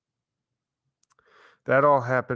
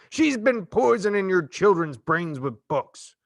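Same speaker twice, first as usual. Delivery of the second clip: slow, higher ranting proclamation; declaring; 8 semitones higher, 5 dB louder than usual. Acted. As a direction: preach it to the mob, slow and loud. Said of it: "Trout declared".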